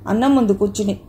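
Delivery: usual speaking voice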